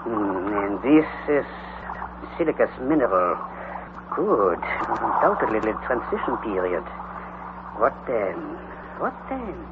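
soft low mutter to himself. Acted. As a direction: murmur while studying the rock walls. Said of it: "muttered again".

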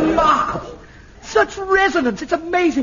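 delighted director raving about resonance; excited praise. Real, not acted. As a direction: gush over the discovery. Director amazed by the voice.

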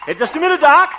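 high, loud shout to stop; calling after someone. Acted. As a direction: holler for him to wait. Calls after departing friend.

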